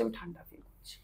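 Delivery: hushed, as if deep in trance; slow quiet voice. Retold or imitated. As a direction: client in trance murmurs she feels cold, whispering. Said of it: "আমি আস্তে আস্তে বলছি".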